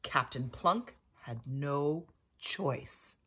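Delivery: ordinary delivery, nothing marked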